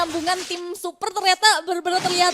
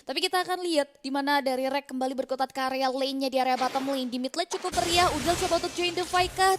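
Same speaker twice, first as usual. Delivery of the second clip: usual speaking voice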